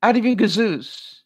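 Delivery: brushing it off, said in a put-on voice; a mock comeback; retorting to people. Speaker quoting her own reply to people, tossed off. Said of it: "And I'm going".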